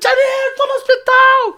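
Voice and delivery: very high, drawn-out mock wail; hammed-up panicked cry down the phone; retold frantic cry. Nephew telling aunt he's in hospital, high frantic wail. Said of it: "Quando eu gritei".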